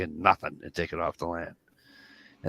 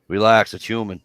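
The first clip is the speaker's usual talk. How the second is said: loud reassurance; 3 semitones higher, 9 dB louder than usual. Retold or imitated. Game warden calls from behind.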